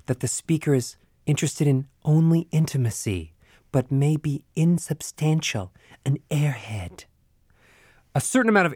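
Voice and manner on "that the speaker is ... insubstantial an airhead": wispy airy tone. Shown as a demonstration. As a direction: let the air take over the sound, breathy to a whisper. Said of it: "the resulting tone is wispy".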